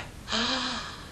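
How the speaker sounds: sharp intake of breath; shocked gasp. Retold or imitated. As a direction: gasp in horror. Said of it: "I gasped".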